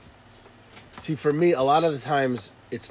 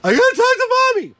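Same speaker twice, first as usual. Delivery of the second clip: put-on much higher, louder little kid voice; child insisting; 5 dB louder than usual. Child demanding to talk to mommy, high and insistent. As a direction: whine like a small child.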